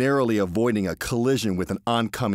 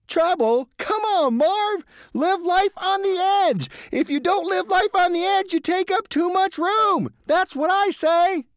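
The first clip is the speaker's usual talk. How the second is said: very high, loud thrill-seeking cheer; reckless glee; 5 dB louder than usual. A narrator's reading. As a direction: egg your friend on with wild delight. George's reckless voice.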